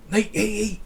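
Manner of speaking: high, loud mock scream; imitated scream. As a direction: yell it out high, imitating an excited chess player. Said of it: "he, like, screamed".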